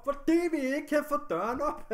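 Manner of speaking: loud, high exasperated outburst; angry shout. Retold or imitated. Retelling the answer to the operator about the blocked door, angry shout. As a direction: shout the explanation as if to someone who won't listen. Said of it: "jeg bliver pisse sur".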